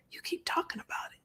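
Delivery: hushed, confiding therapist; whispered quote. Retold or imitated. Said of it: "even my therapist said".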